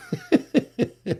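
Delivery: high giggle in quick bursts; amused laugh. Real, not acted. Host teases listeners about being drunk, amused laugh. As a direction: giggle at your own audience.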